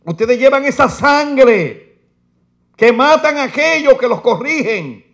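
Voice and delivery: loud, high, slow pulpit reading; fiery preaching. Acted. Preacher reading woes to his church, fiery and loud. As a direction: thunder the verses at the congregation.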